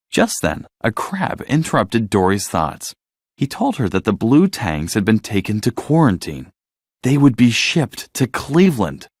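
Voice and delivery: ordinary delivery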